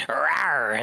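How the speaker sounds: a playful caged-beast roar; mock monster roar. Caged lizard roaring.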